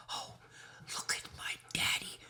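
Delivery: fast breathy whisper. Pretend. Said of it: "voicing the dog".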